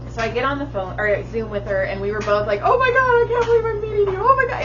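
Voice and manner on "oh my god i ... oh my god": very high, loud, fast squeal; retold gushing excitement. Two women finally meeting on Zoom, squealing with excitement. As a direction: gush like a mock starstruck fan.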